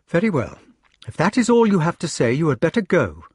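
high-pitched, offended lady; angry dismissal. A narrator's reading. Guinevere sending Lancelot away in a quarrel, offended. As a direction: send him off, pitched well up.

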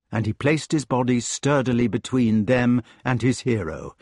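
usual speaking voice

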